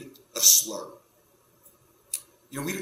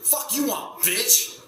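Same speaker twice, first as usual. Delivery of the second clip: much higher, louder, rough door-answering snap; aggressive neighbour; 10 semitones higher, 4 dB louder, much slower than usual. Retold or imitated. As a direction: confront the caller with menace. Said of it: "he answers the door like".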